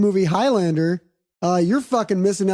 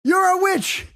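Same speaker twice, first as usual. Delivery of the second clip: much higher hammed-up mob cry; mock witch-hunt crowd, pitched way up; mock angry villagers; 9 semitones higher, slower than usual. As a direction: play a shrill, panicky villager accusing him. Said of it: "And they're like".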